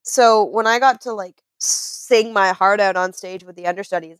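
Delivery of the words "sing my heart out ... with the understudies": ordinary delivery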